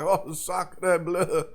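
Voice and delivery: lightly imitated French host accent; a hint of a French TV chef savouring food. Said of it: "it was a French host".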